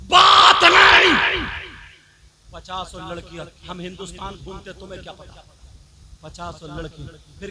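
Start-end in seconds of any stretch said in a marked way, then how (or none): 0.1-1.7 slow, very loud and high shout; thundering command. Real, not acted. Preacher bellows to the congregation, slow shout. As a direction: drag out the ban with a roar. Preacher forbids talking before marriage.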